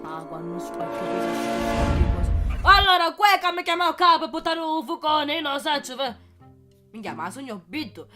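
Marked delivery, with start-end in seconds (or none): 2.7-5.3 higher and louder, excited. Acted. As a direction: burst out excitedly. Excited retelling of the boss.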